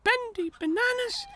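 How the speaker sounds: a sudden bright realisation; excited little tractor. A narrator's reading. Tech works out Henry's problem, high excited voice.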